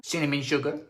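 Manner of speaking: ordinary talk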